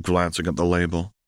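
plain reading voice

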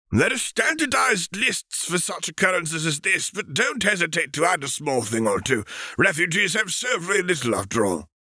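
put-on high, airy voice of an old alien master; alien Jedi master. Thespiasian master briefing Obi-Wan, in a high, stately master voice. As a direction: speak up high like a serene ancient teacher.

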